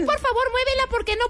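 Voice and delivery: high, shrill pleading voice; mock shrill request to move the van; squeaky pleading. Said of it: "acted parking quarrel".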